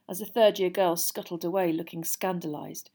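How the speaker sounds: ordinary delivery, nothing marked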